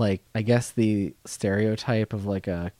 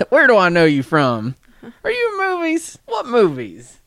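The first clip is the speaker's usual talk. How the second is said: loud, high hammed-up Southern yokel; a clueless Southern fan, overdone; Southern accent; 13 semitones higher, 8 dB louder than usual. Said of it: "You need to work on your Southern accent".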